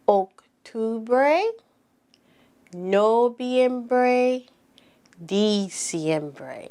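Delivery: months said slowly in Spanish, each rising; drawn-out Spanish vowels, "Enero" to "diciembre". Shown as a demonstration. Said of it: "now let's say it in Spanish".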